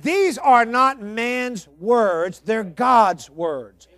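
very slow emphasis. Real, not acted. Preacher slows for emphasis.

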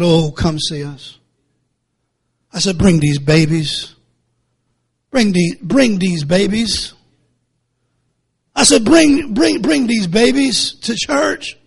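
slow, soft and kind invitation; gently inviting. Retold or imitated. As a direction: coax them kindly to come to church. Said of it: "I was so nice to him".

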